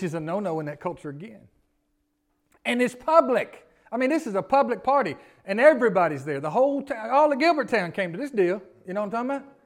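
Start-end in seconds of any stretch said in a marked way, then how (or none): 2.6-8.6 fired-up preaching. Acted. Emphatic preaching on public shame.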